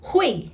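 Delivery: hui with lots of air, like blowing hot soup; airy hui. Shown as a demonstration. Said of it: "you're blowing hot soup".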